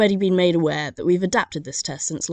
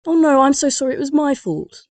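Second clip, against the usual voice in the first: high, louder fluster of apology; apologetic Brit; 9 semitones higher, 6 dB louder than usual. Retold or imitated. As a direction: gush out the apology. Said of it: "So that they then say".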